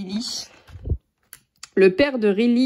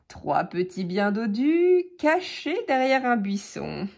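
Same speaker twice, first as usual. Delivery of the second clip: usual speaking voice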